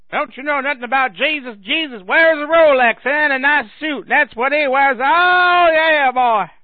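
high, loud hammed-up holler; blustering believer. Believer insisting Jesus dresses rich, mock loud bluster. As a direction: swell into a long whooped finish.

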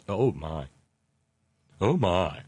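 drawn-out catchphrase in a put-on voice; a half-hearted celebrity impression; George Takei. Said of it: "that's my pathetic George Takei impression".

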